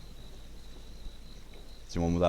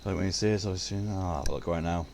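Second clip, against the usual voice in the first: ordinary talk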